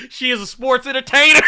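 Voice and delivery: can barely get it out for laughing. Real, not acted. Host cracking up to the stream chat, laughing loud. Cracking up over wrestling meme.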